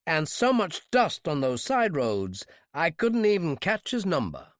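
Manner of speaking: slow, sharpened aggrieved complaint; mild mock anger at the driver; remembered anger. Fugitive feigns anger at a reckless driver to the clerk. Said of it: "My voice sharpened in remembered anger".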